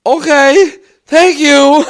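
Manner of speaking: high, loud, scared thanks; frightened stranger. Retold or imitated. Frightened stranger thanks shouting homeowner, high and scared. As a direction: squeak out a terrified thank-you, rising. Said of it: "see the fear in his eye".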